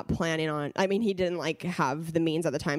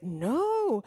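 higher and slowed with disbelief; floored husband; 8 semitones higher than usual. Retold or imitated. Husband telling careful Kels he is floored, disbelieving. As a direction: lightly voice the husband, astonished.

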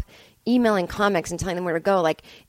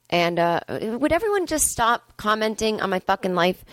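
usual speaking voice

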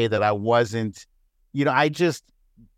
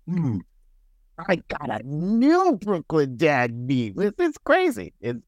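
mock Brooklyn accent; 6 semitones higher than usual. Imitating a troll in a Brooklyn accent, rising to a yell. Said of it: "I'm giving him this accent".

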